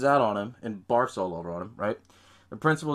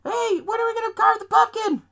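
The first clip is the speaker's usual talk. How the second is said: put-on high-pitched kid shout; loud mock little-boy voice; son yelling from window; 4 dB louder than usual. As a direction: call down like an impatient child. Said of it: "he's on top of the window".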